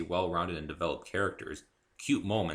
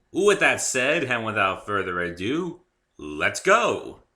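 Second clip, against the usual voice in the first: loud, eager announcement to start; excited kickoff; 7 dB louder, slower than usual. Real, not acted. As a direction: rev up the audience, loud and bright. Excited launch into reading.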